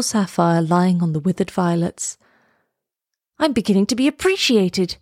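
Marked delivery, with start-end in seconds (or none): 3.4-5.0 mildly excited exclamation; lifted, gently thrilled reading; delighted cry. A narrator's reading. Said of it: "he cried".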